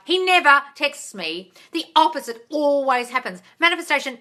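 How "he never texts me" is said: slowed example quote. Shown as a demonstration. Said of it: "If you say things like".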